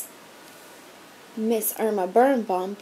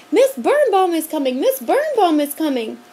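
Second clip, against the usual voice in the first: loud, high urgent warning; girl warning the other kids; crying out warning; 6 semitones higher, 6 dB louder than usual. A narrator's reading. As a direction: alarm the others. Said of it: "she cried".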